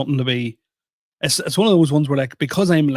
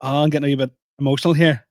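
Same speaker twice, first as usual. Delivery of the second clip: rushed aside; much faster than usual. Retold or imitated. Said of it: "I remember I went".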